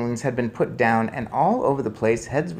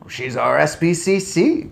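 higher, rising disbelief; astonished cry; 6 semitones higher, 4 dB louder than usual. A narrator's reading. Father recognises accused woman, crying out. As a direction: protest in surprise. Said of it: "cried Mister Jenkins".

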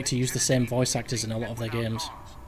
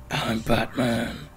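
slow, drawn-out superhero impression; Batman; much slower than usual. Player mocking how the game's hero doesn't sound, drawn-out impression. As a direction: imitate a superhero, stretching out the words. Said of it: "his voice isn't like".

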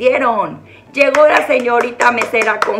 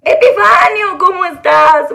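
calling out; 7 semitones higher, 6 dB louder than usual. Real, not acted. Calling out to her pet.

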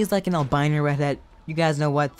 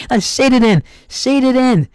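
loud, high "shade it in" repeated; "Shade it in" barked out high and loud; emphatic command; 8 semitones higher, 7 dB louder than usual. Real, not acted. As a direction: hammer home "Now shade it in". Drilling the instruction at viewers.

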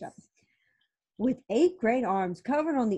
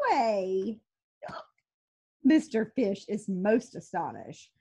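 plain reading voice

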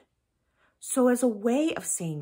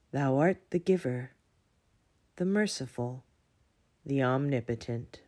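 prayer; 5 semitones lower than usual. Acted. Leads a prayer for children.

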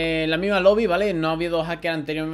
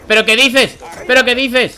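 loud, higher indignant outburst; louder and higher exasperated yell; outraged disbelief; 8 semitones higher, 9 dB louder than usual. Real, not acted. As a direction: shout in disbelief at the screen. Outraged gamer reacting to death.

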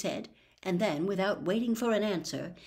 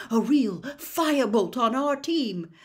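slow, louder, higher proclamation; joyful outburst; 5 semitones higher, 5 dB louder than usual. Acted. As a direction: announce it like a victory. Wood bursts out in joy.

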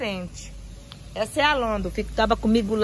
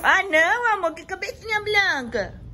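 high, loud cooing pet-talk; 10 semitones higher, 5 dB louder than usual. Real, not acted.

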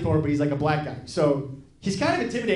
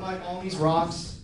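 ordinary talk, nothing marked

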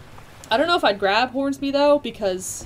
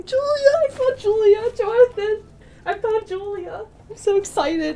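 much higher and louder, squealing; ecstatic shout; 12 semitones higher, 6 dB louder than usual. Real, not acted. Streamer shouts to Jonathan that she found Julia, ecstatic. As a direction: yell the news to your friend.

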